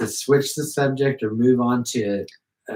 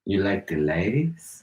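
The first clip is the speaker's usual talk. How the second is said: low, slow, softer come-on; mock-seductive slow drawl; put-on pickup voice; 5 semitones lower, 5 dB quieter than usual. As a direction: stretch it into a sly, low question. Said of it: "you use that exact voice and talk".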